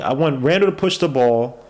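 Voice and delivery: normal talking voice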